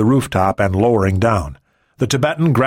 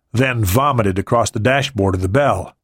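plain reading voice

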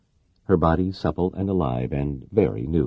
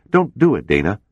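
urgent high, loud plea; loud pleading shipmate; 6 semitones higher, 5 dB louder than usual. A narrator's reading. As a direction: beg her not to go through with it. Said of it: "Gunn pleaded".

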